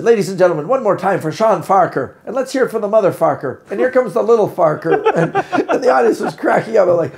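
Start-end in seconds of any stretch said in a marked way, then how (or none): 0.0-4.9 loud MC introduction; show announcer. Acted. Scottish show MC introducing Sean Farquhar, loud announcer delivery. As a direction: announce it to the house, Farquhar as FARKER. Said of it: "the M C said".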